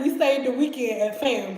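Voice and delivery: usual speaking voice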